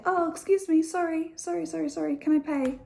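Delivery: high, fast over-apologetic British politeness; polite apology. Retold or imitated. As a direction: apologise for existing while trying to pay. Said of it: "we will say".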